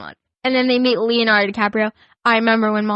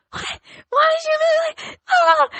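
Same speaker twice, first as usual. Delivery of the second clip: retold yelling. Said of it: "started yelling".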